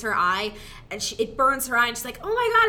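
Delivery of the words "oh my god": high startled cry of pain; shocked yelp. Retold or imitated. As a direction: yelp like your eye just got burned. Burning-eye camera scene, shocked cry.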